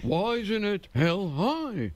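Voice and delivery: high, sing-song comic voice; mock a comic's bouncy singsong delivery; high sing-song observational comic spoof; sing-song Seinfeld parody. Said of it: "going up on stage going".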